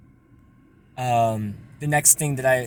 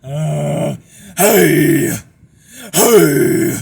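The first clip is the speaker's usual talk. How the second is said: much louder raspy hey; distorted shout; 13 dB louder, much slower than usual. Shown as a demonstration. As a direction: shout it with the rattle. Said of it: "like, a shout".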